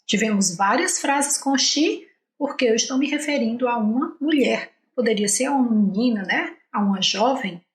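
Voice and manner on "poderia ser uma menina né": normal talking voice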